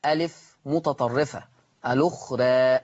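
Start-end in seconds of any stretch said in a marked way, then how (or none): 1.9-2.8 الأخرى with the last vowel tilted toward "ee"; lower-pitched الأخرى with fronted ending; taqlil. Shown as a demonstration. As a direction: say الأخرى as "al-ukhrae". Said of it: "مقلل ليه قولا واحدا".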